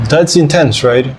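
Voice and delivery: ordinary talk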